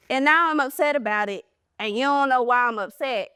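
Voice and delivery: ordinary talk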